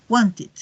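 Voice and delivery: "wanted" with a separate "id" syllable; ID ending. Shown as a demonstration. Teacher confirms the rule, "wanted" ending in a neutral "id". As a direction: model the extra syllable closing "wanted". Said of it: "van con I D".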